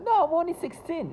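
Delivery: put-on high-pitched retelling of a girl's reply; sixteen-year-old girl. Sister answering a marriage question, imitated high girl voice.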